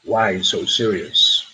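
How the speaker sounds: low, slow, drawn-out movie villain; deep, deliberate menacing quote; film villain quote. Acted. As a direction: drop low and stretch each word. Acted film villain quote for quiz.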